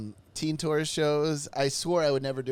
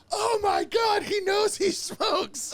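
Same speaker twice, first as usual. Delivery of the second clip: excited shriek; much slower than usual. Retold or imitated. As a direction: shriek it like starstruck teens. Crowd of teens gasping.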